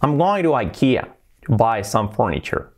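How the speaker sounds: ikea begins with an "eye" sound; English pronunciation. Shown as a demonstration. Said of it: "Nada de ikea".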